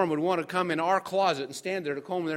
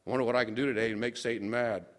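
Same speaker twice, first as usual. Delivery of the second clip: mischievous aside; 6 semitones lower, faster than usual. Retold or imitated.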